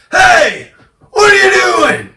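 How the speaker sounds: loud, high furious shout; angry man yelling. Retold or imitated. Angry landowner yelling at the intruders. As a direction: bark at the trespassers. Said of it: "Got this guy".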